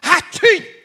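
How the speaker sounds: loud, high mock "atchim"; sudden hammed-up sneeze; imitated sneeze. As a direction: burst out a pretend sneeze. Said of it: "acabou de espirrar".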